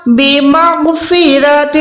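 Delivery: بمغفرۃ with a thick, heavy غ; heavy ghain. Shown as a demonstration. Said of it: "غین موٹا".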